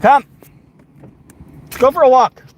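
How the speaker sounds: high, loud call to the dog. Real, not acted. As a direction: call out to get the dog moving. Calling dog to walk.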